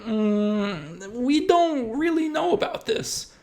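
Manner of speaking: French settlers voiced high and wary on arrival; doubtful French settlers. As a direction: play a hesitant settler unsure of the place. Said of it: "and they were like".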